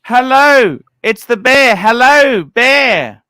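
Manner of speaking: loud, high calling out; calling out loudly. Real, not acted. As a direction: call out over the line. Calling out to the bear.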